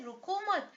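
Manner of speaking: रुको मत run together as one loud phrase; pause after मत. Shown as a demonstration. Teacher showing the comma moved, रुको मत joined as one command. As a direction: join मत onto रुको, then break after मत. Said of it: "यहाँ लगा दूं तो इसका अर्थ बदल जाएगा".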